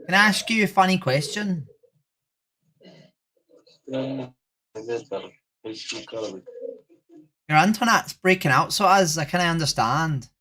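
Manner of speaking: put-on Irish accent greeting; chirpy fake Irish brogue. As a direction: say hello like a cheery Irish local. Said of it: "Irish accent for the rest of the stream".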